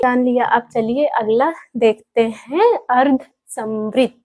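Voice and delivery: ordinary delivery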